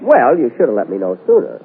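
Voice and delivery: normal talking voice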